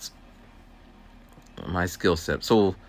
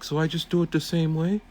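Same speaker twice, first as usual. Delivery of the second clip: student voice; 11 semitones higher than usual. Retold or imitated. Student asking about problem.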